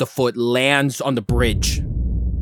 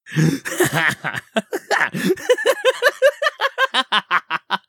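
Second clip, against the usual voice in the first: long, high, staccato witch cackle; hag cackle. Acted. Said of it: "As the one hag says".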